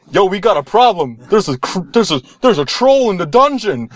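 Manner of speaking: high-pitched, stumbling mock alarm; urgent warning. Rapper warning the hall about a troll, alarmed. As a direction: blurt the warning in a comic fluster. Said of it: "everyone's flipping out".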